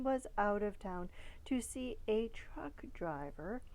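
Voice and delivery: ordinary delivery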